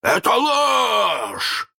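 high, long, loud roar; outraged drawn-out shout; hoarse roar. A narrator's reading. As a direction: bellow the denial, stretching the last word. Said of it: "хрипко взревел Крюк".

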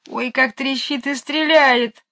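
high, drawn-out cry of complaint; pained cry. A narrator's reading. Jane, clutching her aching ear, crying out. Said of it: "крикнула она".

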